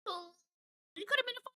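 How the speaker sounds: high, soft, hammed-up shock; mock gasp. Watching the bride fall off the boat, high mock shock. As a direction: gasp it like a stunned audience.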